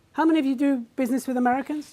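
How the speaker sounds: normal talking voice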